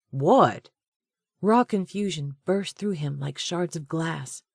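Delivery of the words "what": louder, cutting demand; sharp angry what. A narrator's reading. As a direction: bite off the word in anger. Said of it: "death glare".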